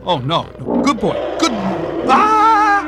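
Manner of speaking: high, loud frantic yelling; panicked shouting. Acted. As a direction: scream as animals attack. Animals attack everyone.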